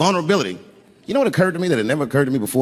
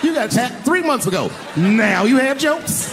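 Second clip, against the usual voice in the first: higher, rising to a slow shout; indignant, building to a yell; furious inner rant; 7 semitones higher, slower than usual. Retold or imitated. Silently raging at Chris Rock stealing the moment, shouting. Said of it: "I'm thinking in my mind".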